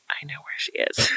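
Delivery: soft unvoiced aha; whispered realization. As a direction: breathe it out as it dawns.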